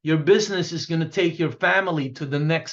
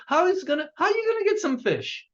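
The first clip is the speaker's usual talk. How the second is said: incredulous question; 10 semitones higher, faster than usual. Real, not acted. Rhetorical question to audience.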